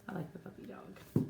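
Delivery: soft whisper; hushed, voiceless remark; whispered aside. Real, not acted. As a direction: whisper it as a side comment. Quiet aside about the picture.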